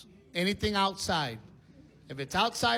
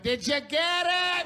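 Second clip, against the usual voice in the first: high, stretched-out question; wife calling out; 10 semitones higher, much slower than usual. Retold or imitated. Wife asks husband about the spider, calling high and slow. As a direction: call it slowly across the house.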